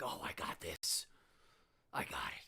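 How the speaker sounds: hushed confident whisper to himself. Retold or imitated. Kid quietly psyching himself up before the contest, whispered. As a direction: breathe it like a secret promise.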